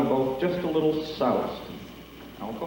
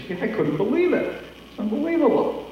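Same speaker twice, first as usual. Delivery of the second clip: amazed disbelief; 10 semitones higher than usual. Acted. Friend amazed at the trick.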